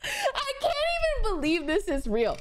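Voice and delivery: real laugh through the words; can barely get it out for laughing. Real, not acted. Telling friends about the crash, laughing in disbelief.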